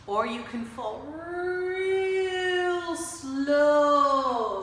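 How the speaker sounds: slow, sung-out falling voice, rising and loud; drawn-out singsong fall; falling real slow. Shown as a demonstration. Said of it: "like this".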